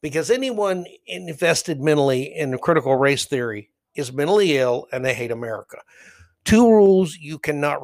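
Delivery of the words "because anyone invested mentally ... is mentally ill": ordinary talk, nothing marked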